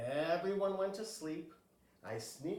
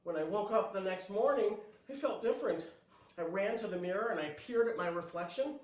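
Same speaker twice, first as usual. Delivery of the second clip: ordinary delivery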